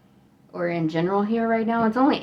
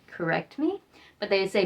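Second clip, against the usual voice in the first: ordinary delivery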